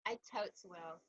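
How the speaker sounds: barely audible mumble; too quiet. Real, not acted. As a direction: say it so soft she can't hear. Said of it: "I can't hear you".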